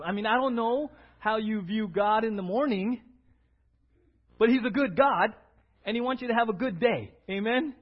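usual speaking voice

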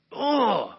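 high, loud exasperated "ugh"; loud groan. Retold or imitated. Man showing how hurt he was, loud groan. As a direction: groan out pent-up frustration. Imitates his own raging groan.

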